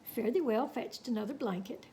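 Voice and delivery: ordinary delivery, nothing marked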